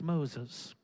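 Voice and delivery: soft, low call; the Lord calling. Acted. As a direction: say the name softly and low. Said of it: "when the Lord says it twice".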